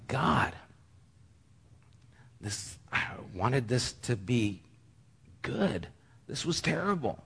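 a quiet echo of weeping at the failed concert; retold weeping. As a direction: let the voice go thin and tearful as if reliving it. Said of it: "I literally was just weeping".

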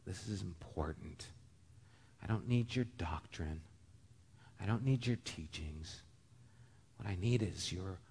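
soft, near-whispered pleading; hushed plea. Acted. Mother pleading quietly with the prophet for her son. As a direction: beg quietly, as if at a bedside.

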